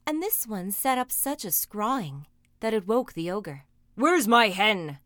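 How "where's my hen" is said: loud, drawn-out giant bellow; booming storybook roar; ogre's shout. A narrator's reading. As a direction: shout it slowly like an outraged ogre. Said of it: "he shouted".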